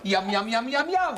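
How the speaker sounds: rising, gleeful yum yum. Acted. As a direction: smack your lips over a treat. Diner relishes food.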